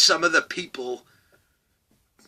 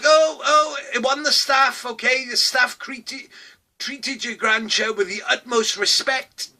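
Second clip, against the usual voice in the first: higher voice, a touch of sarcastic mock sweetness; mocking confession; 7 semitones higher than usual. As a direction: confess smugly to the grandson. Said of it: "And I'll be like".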